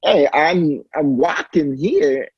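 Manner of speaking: mock Walken line; Chris Walken impression. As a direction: play it like Chris Walken, stretched out. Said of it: "That's a walking impression".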